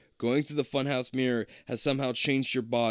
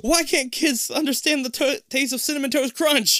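mock cereal ad; 13 semitones higher, 5 dB louder than usual. Mock ad voice joke.

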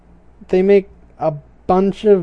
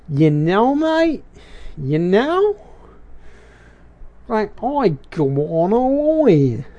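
mock Australian accent. Shown as a demonstration. Keeping the iguana's voice going, in a playful mock Australian accent. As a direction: toss off a playful mock Aussie "mate". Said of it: "Kinda like what I'm doing now".

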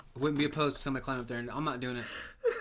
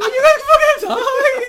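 far higher, much louder baffled yell; shocked shout; 20 dB louder than usual. Retold or imitated. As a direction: scream it in laughing disbelief. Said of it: "I'm like".

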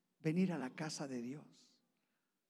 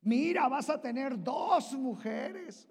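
tempting Satan; 8 semitones higher, 5 dB louder, slower than usual. Pretend. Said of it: "Él te va a presentar".

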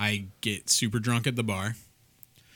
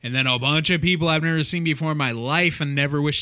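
louder, pitched up, getting worked up; bursting with the story, raising the voice; louder storytelling; 6 semitones higher, 8 dB louder than usual. Real, not acted. Telling a friend about a bad concert, loud and worked up.

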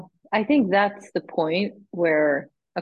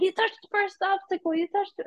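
very high, repetitive mock nagging; retold insistence; 13 semitones higher than usual. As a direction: imitate a marshal insisting in a high voice. Retold marshal repeating herself.

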